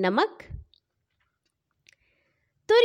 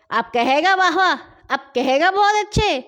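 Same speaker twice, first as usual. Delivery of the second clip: loud, higher furious taunt; angry shouting; 7 semitones higher, 3 dB louder than usual. Acted. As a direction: yell while beating him. Men beating him, shouting. (مارتے ہوئے غصے سے چلانا)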